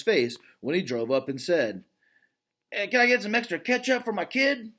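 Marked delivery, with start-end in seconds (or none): none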